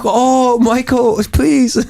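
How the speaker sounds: very high put-on Chinese accent; squeaky Chinese-accented grandma. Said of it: "She's like".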